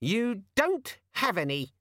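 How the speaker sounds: high, slow, sullen squeak; sulky squeaky demon. A narrator's reading. Demon admitting to Vimes there are no appointments, sulky. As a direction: sulk in a small squeaky voice. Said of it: "said the demon sulkily".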